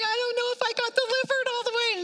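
high, fast, nervous blurt; anxious blurt. Retold or imitated. Confessing to the church lady, a high, fast, nervous blurt. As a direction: spill the fear out in a rush.

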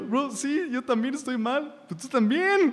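high-pitched retort, acted out; mock pleading reply. Pastor replaying his answer to a defensive person, mock pleading. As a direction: push the pitch up as you concede and counter.

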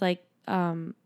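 ordinary delivery

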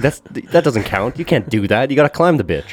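high-pitched, protesting rant; worked-up but joking complaint; comic indignation. Real, not acted. Comic rant at explorer's false claim.

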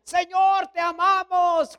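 high, stretched-out devotional call; mock shouting. Young climbers declaring love to God, mock shouting. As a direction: imitate a youth crying out to the sky. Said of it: "de repente gritábamos".

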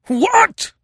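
a chief roaring in disbelief; explosive roar of outrage. A narrator's reading. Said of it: "roared Yar Afzal".

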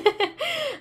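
a sudden high burst of laughter. Real, not acted. Teacher laughing at her own slip.

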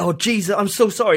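high, hammed-up sorry; mock apology. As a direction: pretend to be shocked and sorry. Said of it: "And you're like".

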